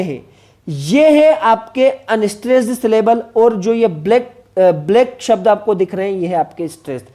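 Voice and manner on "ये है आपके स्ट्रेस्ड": ordinary talk, nothing marked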